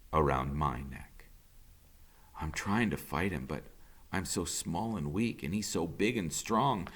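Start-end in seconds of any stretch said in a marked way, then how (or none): none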